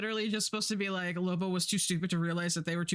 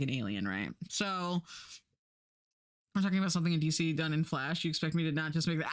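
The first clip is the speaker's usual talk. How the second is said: ordinary talk, nothing marked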